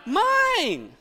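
put-on high, drawn-out childish claim; high-pitched playful "mine"; possessive "mine". Shown as a demonstration. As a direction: lead the crowd in a mock kid cry. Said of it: "Say it with me, come on".

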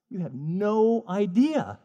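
slow, knowing God voice, lightly voiced; God's voice. God looking down at the listener, slow and knowing. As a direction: draw it out like a patient God.

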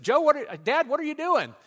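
put-on high, loud young boy calling to his dad; child's voice. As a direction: play the excited little son. Son voiced in retold story.

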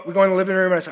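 normal talking voice

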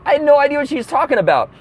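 usual speaking voice

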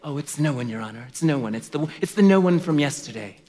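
lightly voiced snooty clerk, a bit hushed; snooty court clerk. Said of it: "the snooty court clerk would say".

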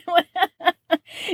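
a real laugh, high and bubbling; bright, delighted giggle; amused laugh. Real, not acted. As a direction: laugh at the absurd idea. Laughs at her own joke.

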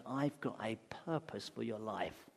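God's words given as a hushed whisper. Acted. God promising Jacob a purpose in a vision, whispered. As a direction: breathe it like a secret promise.

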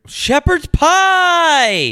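high, drawn-out shout; loud silly outburst; exclaimed pun. Pretend. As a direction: holler it like a big announcement. Bursts out with pun.

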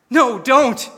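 high, loud, panicked cry of no; frantic plea. Acted. Little boy frantically begging his sister not to tell, panicked. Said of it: "he replied frantically".

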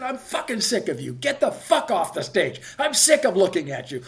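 high, loud ordering him off; reenacted yelling. Ordering the actor off the stage, yelling. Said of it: "yelling at each other".

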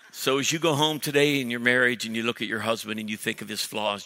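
ordinary talk, nothing marked

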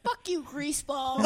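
high, slow scornful woman; a high, slow, hammed-up brush-off; high mock woman voice. Quoting the girl's reply.

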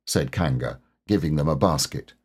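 ordinary delivery, nothing marked

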